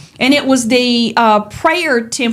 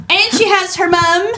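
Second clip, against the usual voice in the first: put-on Australian accent; 6 semitones higher than usual. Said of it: "I'm trying so hard to do an Australian accent".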